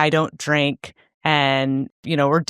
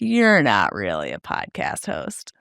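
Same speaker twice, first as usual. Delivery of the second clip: low, doubting inner critic; 7 semitones lower than usual. Retold or imitated. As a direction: voice the nagging doubt in your head, low and mocking. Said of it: "a big voice in my brain that is like".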